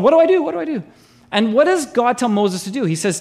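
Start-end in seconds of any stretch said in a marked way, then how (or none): 0.0-0.8 mock panic. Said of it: "Moses asks God".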